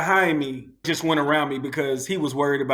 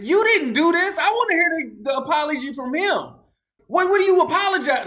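much higher and louder, snapping rant; 13 semitones higher, 4 dB louder than usual. Retold or imitated. Driver snapping at the couple who bumped his car, demanding. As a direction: snap at them and demand the apology. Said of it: "I'm snapping again".

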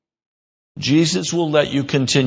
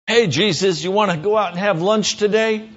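"Hey, Jesus" pitched higher, breezy and chummy; mock chummy invite; 7 semitones higher than usual. Preacher mocking a flippant lunch invite to Jesus, higher and breezy. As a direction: put on a breezy buddy voice, higher. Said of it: "You do not come up to Jesus and say".

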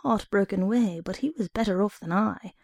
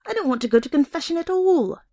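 desperate protest; 8 semitones higher, 3 dB louder, faster than usual. A narrator's reading. Boy protesting to sister.

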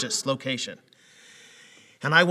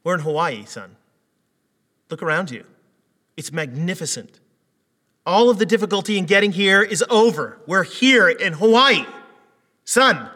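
building from coaxing to loud pleading; exasperated father; 5 semitones higher, 10 dB louder than usual. Retold or imitated. Dad pleading with his crying son in Hawaii, rising exasperation. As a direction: lose patience with him, louder.

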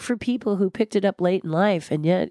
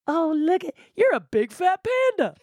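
high sneering mock voice, much higher; mocking taunt; 11 semitones higher than usual. Imitating mockers jeering at the big fat panda, high and sneering. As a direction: taunt like a schoolyard bully. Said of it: "We love to watch people go".

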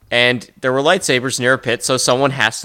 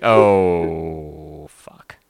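dread; 9 semitones lower than usual. Pretend. Rebel sees Vader.

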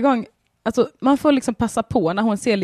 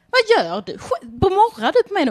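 mock outrage; 10 semitones higher than usual. Owner scolding her growling cat, high and mock indignant. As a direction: act offended at the cat. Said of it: "så bara".

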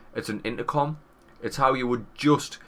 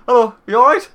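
parents asking; 12 semitones higher, 11 dB louder than usual. Retold or imitated. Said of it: "they're like".

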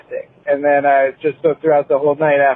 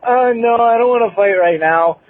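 higher-pitched mock reluctance; joking refusal; 8 semitones higher than usual. Coworker jokingly refusing a fight, higher mock protest. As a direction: play-act backing out of a fight.

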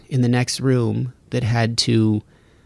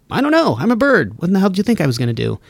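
high, then fast sassy bird; higher bird voice, sarcastic; 5 semitones higher, faster than usual. Retold or imitated. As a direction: shrug it off as a cheeky bird. Voicing a bird.

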